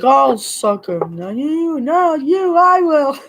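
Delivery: drawn-out mock boast climbing high; put-on taunt, rising way up in pitch; mocking imitation. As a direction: start slow, then soar into a sing-song high voice. Mocking quote of the boyfriend.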